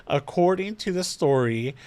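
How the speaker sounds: ordinary talk, nothing marked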